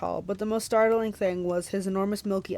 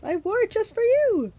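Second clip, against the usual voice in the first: coy, very high falsetto; high-pitched put-on bridal simper; Grover; 13 semitones higher, slower than usual. A narrator's reading. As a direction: flirt like a fake bride. Grover flirts in falsetto disguise.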